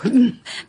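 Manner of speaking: a short, high burst of relieved laughter. Acted. Partner laughs with relief after the deal is agreed, short bright laugh. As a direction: laugh with relief that it's settled.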